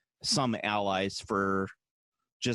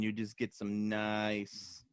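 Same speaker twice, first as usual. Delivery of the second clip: put-on slow, softer Eastern European accent; Borat-like accent; 7 dB quieter, much slower than usual. Host defending Eastern European food, Borat-like accent. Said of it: "bring back Borat".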